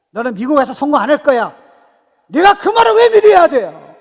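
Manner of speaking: loud taunt rising into a high roar; defiant shout. Acted. Believer refusing to believe he will fail, defiant shout. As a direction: build to an indignant full-voice retort. Acted refusal of discouraging words.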